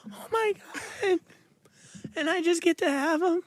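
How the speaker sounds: high, breathless gasp of disbelief; amazed disbelief. Retold or imitated. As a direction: squeal it like you can't believe your luck. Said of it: "And you're like".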